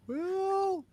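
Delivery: very high, soft far-off call; small, high, drawn-out distant cry; muffled distant yell. Pretend. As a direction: shout as if from far away, muffled. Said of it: "in a very muffled moment".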